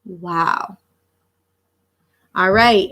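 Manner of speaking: usual speaking voice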